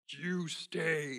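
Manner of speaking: suara robot yang ditirukan ringan; a light, brief robot imitation. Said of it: "in his robot voice".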